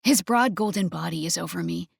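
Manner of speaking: plain reading voice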